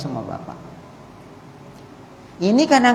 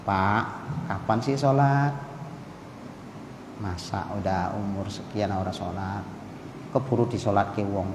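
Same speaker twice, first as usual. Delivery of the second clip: soft, lower and quieter coaxing; gentle reminder; 10 semitones lower, 10 dB quieter than usual. Retold or imitated. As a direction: nudge the husband kindly, no pressure. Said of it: "nasihatnya itu yang pas-pas aja". (istri menasihati suami dengan lembut dan pelan)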